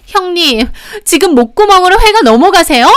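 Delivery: high, loud furious outburst; angry yelling. A narrator's reading. Youngest sister-in-law attacks the elder one, angry yelling. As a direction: yell the accusation at full pitch. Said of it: "화가 나서 소리를 지르더라고요".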